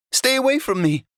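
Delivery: a cornered little dog crying out; frightened poodle cry. A narrator's reading. Little poodle cries to snarling dogs, high frightened cry. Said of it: "the little poodle cried".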